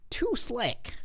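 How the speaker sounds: high, hushed aside; mock slick. A storyteller mocking his slick hair, squeaky aside. Narrator mocks own slick look.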